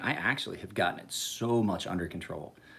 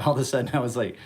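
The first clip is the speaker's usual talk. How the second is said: ordinary talk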